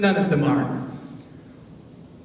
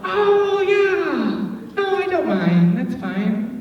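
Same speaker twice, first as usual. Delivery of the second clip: high, sweet, tight-lipped fake agreement; passive-aggressive; 9 semitones higher, much slower than usual. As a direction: pretend you don't mind at all. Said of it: "passive aggression".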